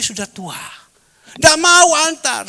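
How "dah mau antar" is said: high, loud mock-tearful old woman; imitated crying friend. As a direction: wail like a weeping old friend. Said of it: "nangis dia".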